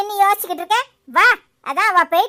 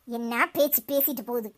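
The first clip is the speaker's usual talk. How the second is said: muttered disgust; 7 semitones lower, 10 dB quieter than usual. Acted. Muttering after rival leaves.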